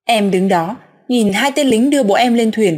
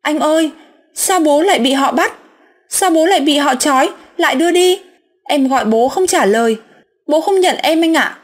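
higher, upset, tearful voice; tearful questions; 7 semitones higher than usual. Retold or imitated. Sister asking older brother why father was taken, tearful. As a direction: ask your brother through tears. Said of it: "Em ngồi khóc".

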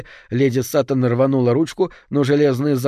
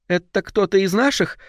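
shouting; 8 semitones higher than usual. Acted. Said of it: "крикнула леди Саттон".

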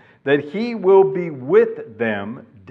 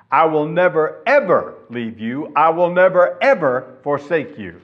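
firm punch on "never, ever" each time; emphatic; 4 dB louder than usual. Shown as a demonstration. Preacher explains God's promise, emphatic on "never, ever". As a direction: drive home "never" and "ever" in both halves. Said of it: "This is the most emphatic statement".

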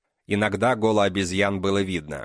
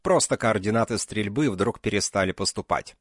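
plain reading voice

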